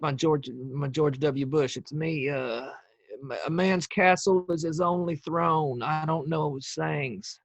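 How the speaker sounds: George W. Bush. Acted. Podcaster joking about a ghoul in the attic, Bush impression. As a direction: do a halting Bush impersonation. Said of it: "That's a pretty good Bush".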